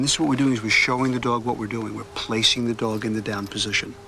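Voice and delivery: ordinary talk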